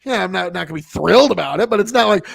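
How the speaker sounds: ordinary talk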